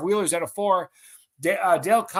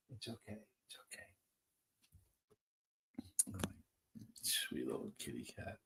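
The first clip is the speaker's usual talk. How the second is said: hushed, much softer and lower pet talk, partly whispered; calm a frightened kitty gently; soothing the cat; 6 semitones lower, 14 dB quieter than usual. Real, not acted. Host soothing the cat his shout scared, hushed and tender.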